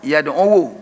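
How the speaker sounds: "Yad'u" pronounced yad-'u-wu; dhamma on waw. Shown as a demonstration. Teacher demonstrates the readable waw, "Yad'u" with dhamma sounded as -wu. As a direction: add a short -wu after the long u of "Yad'u".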